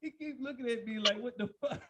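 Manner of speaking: high, loud squeal through laughter; laughing. Real, not acted. Guest imitating the other man's baffled stare, laughing. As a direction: lose it laughing as you complain.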